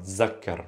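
"zakkar" softly, with a clear z; dialect z sound. Shown as a demonstration. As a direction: say "zakkar" with z in place of th. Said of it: "with a z sound".

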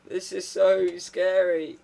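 slow, drawn-out mock dread; mock fear. Player sent into the test chamber, mock scared. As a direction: stretch it out, badly faked fright. Playing frightened before the test.

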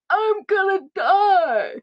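slow, high, loud melodramatic wail; wailing panic. Retold or imitated. Daughter panics to mum that she'll die, hammed-up wail. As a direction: howl it like a doomed teen.

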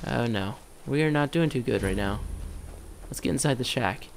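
ordinary talk, nothing marked

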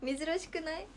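高い声で泣き笑い; こらえきれずに吹き出す; 笑い崩れる. Real, not acted. 無茶ぶりに笑い崩れる. (泣き笑いで突っ込む)

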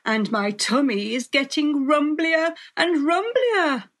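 loud, rising hungry-wolf roar; ravenous storybook villain. A narrator's reading. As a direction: build to a high, loud peak. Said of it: "roared the wolf".

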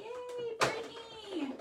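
high, drawn-out little cheer; delighted cheer. Real, not acted. Crafter cheering a viewer on the livestream, high and delighted.

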